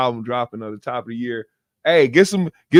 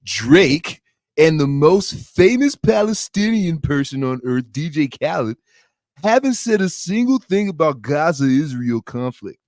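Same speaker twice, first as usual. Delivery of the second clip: slow nasal mocking interviewer voice; nasal voice with snot in the throat; DJ Vlad voice; 4 semitones higher, 4 dB louder than usual. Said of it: "I gotta have a nasally voice".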